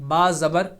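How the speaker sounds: ba said short, vowel not stretched; quick clipped ba with a short a. Shown as a demonstration. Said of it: "یہ با کے اوپر میں نے زبر لگائی ہے".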